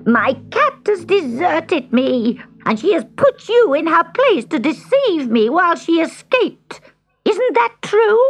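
high, loud witch accusation; raging witch. A narrator's reading. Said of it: "raged the witch".

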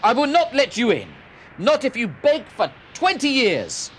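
loud, high panicked refusal; man refusing the ghost, loud and high; frightened shout. A narrator's reading. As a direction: shout him off in terror.

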